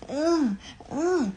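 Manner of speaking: high strained whines; imitated baby squirming. Mother showing her one-year-old squirming, high strained grunts. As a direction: mimic a baby straining and fussing. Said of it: "he crying though".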